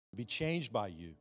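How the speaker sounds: loud, high, fervent preacher cry; pleading preacher altar call. Real, not acted. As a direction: preach the altar call at full force over music. Altar call at full force.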